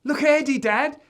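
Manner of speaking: put-on high, loud excited kid; gleeful child's shout; giggly boy. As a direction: call Dad over, tickled by your brother. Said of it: "Joe starts giggling back at Eddie".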